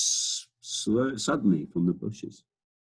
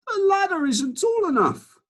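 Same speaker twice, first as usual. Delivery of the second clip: gardener calling back; 5 dB louder than usual. Acted. Gardener calling to the Duke from the ladder, much higher. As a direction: call up apologetically from the ladder. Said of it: "the gardener called back".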